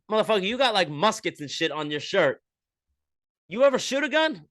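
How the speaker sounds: high, loud teasing question; mock comedian roast. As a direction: poke fun like a stand-up in the crowd. Comedian roasting the shirt logo.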